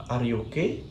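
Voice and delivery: "okay" lifts at the end; closed question, pitch climbing on "okay"; rising question intonation. Shown as a demonstration. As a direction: finish "okay" on an upward glide. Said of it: "a rise at the end".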